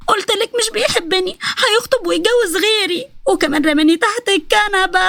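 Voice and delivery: high, drawn-out sobbing wail; crying. Acted. Nesma complains he doesn't love her, crying. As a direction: wail slowly through theatrical sobs. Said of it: "نسمة من تحت الكنبة اللي قعدت تعيط".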